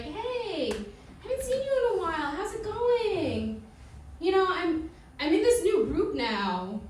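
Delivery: high, sugary, drawn-out friend; sweet recruiter voice. Retold or imitated. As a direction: lure them in sweetly.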